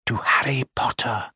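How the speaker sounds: whispered, slow and soft reverent toast; hushed toast. A narrator's reading. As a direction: breathe the words like a secret toast. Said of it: "saying in hushed voices".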